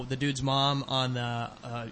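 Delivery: ordinary talk, nothing marked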